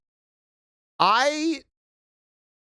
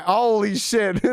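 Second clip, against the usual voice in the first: slow, higher amazed holy shit; stunned surprise; 5 semitones higher, 3 dB louder, slower than usual. Real, not acted. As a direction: drag it out in shock. Shocked at TTS reading.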